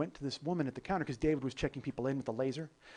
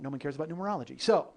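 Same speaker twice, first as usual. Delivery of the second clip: normal talking voice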